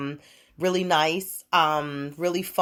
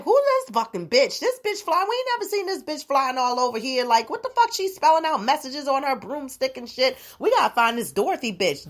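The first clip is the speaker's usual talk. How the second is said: outraged townsfolk; 7 semitones higher than usual. Acted. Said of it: "They was like".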